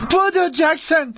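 high, drawn-out call; urgent shout. Acted. Imabelle urging Jackson to flee, urgent shout. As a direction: urge him to run, slow and high.

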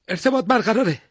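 put-on high, loud young radioman; bright-pitched shouted acknowledgement; eager radioman's report. A narrator's reading. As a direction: report back promptly, voice lifted high. Radioman reports back at once.

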